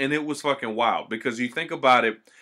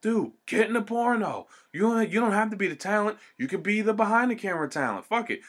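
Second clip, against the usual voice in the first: raised-pitch eager pitch from a friend; imitating a pushy buddy; 8 semitones higher than usual. Friend urging him to shoot porn, mock eager pitch. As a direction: sell the idea like a hyped homie. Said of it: "I had the people was like".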